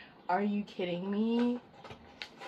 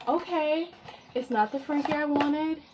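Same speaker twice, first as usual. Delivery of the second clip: surprised; 7 semitones higher, 5 dB louder than usual. Real, not acted. Pulls a different blind box figure.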